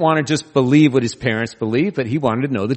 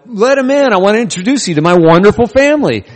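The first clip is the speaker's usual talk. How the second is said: much higher, louder, faster mock-sweet host; mock sugary welcome; 9 semitones higher, 7 dB louder than usual. Preacher mocking the polite welcome Jesus never gave, high and gushing. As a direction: gush like a delighted host.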